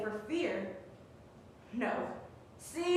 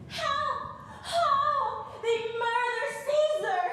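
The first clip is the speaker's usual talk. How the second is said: high, loud frantic cries of help; crying out for help; 9 semitones higher, 5 dB louder, slower than usual. Acted. Caesar voicing his wife's cries in her sleep, high and loud. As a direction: cry out like a woman waking from a nightmare. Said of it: "in her sleep cried out".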